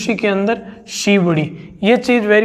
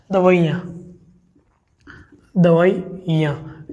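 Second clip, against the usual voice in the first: दवाइयाँ stretched very slowly, each syllable clear; slow correct spelling; 2 semitones lower, slower than usual. Shown as a demonstration. Teacher showing students the correct दवाइयाँ slowly. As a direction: dictate दवाइयाँ slowly to the class. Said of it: "ये शुद्ध शब्द होता है साथियों".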